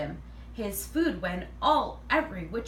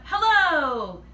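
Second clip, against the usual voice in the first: loud booming shout, pitched up high; yelled hello; 7 semitones higher, 8 dB louder than usual. Acted. New boy suddenly yells hello to the class, booming shout. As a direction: boom it out so the whole class jumps. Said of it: "then yelled out".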